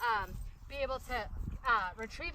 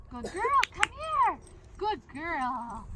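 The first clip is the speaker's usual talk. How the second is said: excited praise; 5 semitones higher, 6 dB louder, much slower than usual. Real, not acted. Owner praising her dog Honey with a high, loud, excited voice. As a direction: gush over her like a proud owner.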